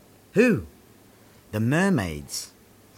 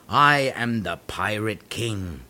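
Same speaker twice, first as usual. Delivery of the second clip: ordinary talk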